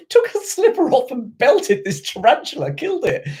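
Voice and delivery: high, laughing through the words; helplessly amused, voice riding high. Real, not acted. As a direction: tell it through real laughter, pitch leaping up. Laughs while telling nan anecdote.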